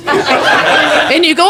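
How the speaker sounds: usual speaking voice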